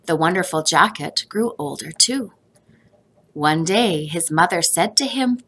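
ordinary delivery

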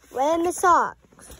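real exasperation over lost socks; Where are my socks, loud and drawn out in frustration; frustrated. Real, not acted. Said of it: "I don't say that when I'm angry".

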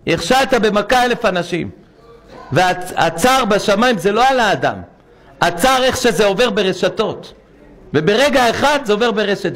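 hammering the congregation; fiery preaching. Acted. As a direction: thunder it from the pulpit. Preacher rises to full force.

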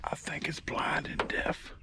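hushed, voiceless whisper. Real, not acted. Sneaking up on a turtle, whispering to the viewers. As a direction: whisper so the turtle stays put.

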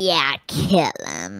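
low, slow, menacing; slow evil command; sinister low voice. Acted. Sister coldly orders kill.

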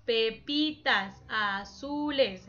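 "pepitas azules" slowly, one voice beat per syllable; slow syllable demo of "pepitas azules". Shown as a demonstration. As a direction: break pepitas azules into separate slow beats. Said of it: "El golpe de voz".

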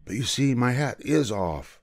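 put-on mildly deferential boy's voice; very polite. A narrator's reading. Boy shows the king the hat in his hand, politely. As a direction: explain it gently to a superior. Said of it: "said Bartholomew very politely".

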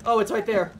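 normal talking voice

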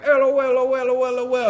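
high, sustained mock laugh; comic imitation of happy crying; crying with laughter, imitated. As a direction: babble a fake laugh on one high note. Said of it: "crying in that good way where it's like".

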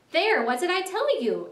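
high, triumphant exclamation; exclaiming. A narrator's reading. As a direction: exclaim it, proven right. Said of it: "exclaimed the rat".

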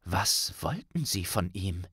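plain reading voice

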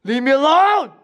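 high, drawn-out cry to be left alone; panicked high wail; desperate yell. Acted. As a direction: cry out desperately, pitch soaring. Crying out at the attacker.

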